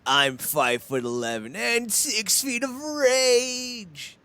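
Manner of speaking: slow, high hammed-up wrestler bravado; mock tough guy. Mocking the wrestler's promo line, high slow bravado. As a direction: puff up like a cartoon tough guy.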